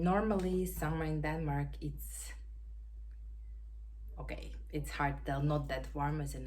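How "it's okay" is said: usual speaking voice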